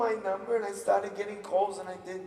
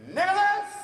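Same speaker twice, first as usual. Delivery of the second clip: much higher, louder mock scolding call; angry mom; 14 semitones higher, 7 dB louder than usual. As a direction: imitate a mother summoning her son angrily. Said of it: "calls me Nicholas when she's angry".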